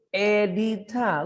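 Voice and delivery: slow, stretched-out syllables. Shown as a demonstration. Teacher counts syllables on fingers, syllable by syllable. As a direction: count it out part by part. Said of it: "look at my fingers".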